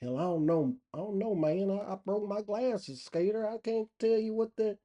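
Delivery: mock befuddled local; puzzled country buddy. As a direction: answer helplessly in a light, high country voice. Buddy answers the gawker.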